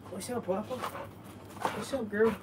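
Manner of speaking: put-on high, soft baby talk to a dog; high pet voice. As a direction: coo at the puppy.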